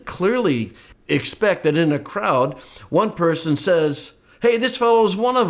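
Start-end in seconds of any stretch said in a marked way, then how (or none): 4.4-5.5 high, loud, fast jeering call; accusing bystander. Pretend. Bystander in the courtyard calling out Peter, high mocking accusation. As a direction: point him out to the crowd. One person in the crowd accuses.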